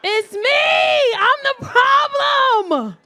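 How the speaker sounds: high, drawn-out full shout; shouted self-blame. Acted. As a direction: holler the confession, stretching the last word. Comic confession blown up.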